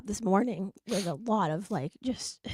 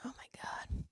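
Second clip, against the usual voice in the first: hushed gasp of shock; whispered shock at the scorpion; awed whisper; 15 dB quieter, slower than usual. Real, not acted. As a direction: breathe it out stunned. Scorpion in closet reaction.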